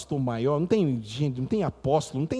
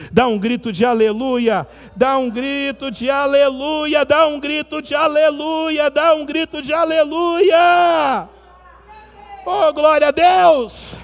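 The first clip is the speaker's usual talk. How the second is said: ever higher, louder, slower call; building shout; 10 semitones higher, 6 dB louder, slower than usual. Acted. Preacher urging the church to shout hallelujah, building to a shout.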